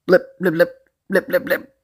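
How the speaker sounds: high, rapid popping blips; blipping sound effect. Acted. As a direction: imitate slime pellets firing in bursts. Imitating slime shots being fired.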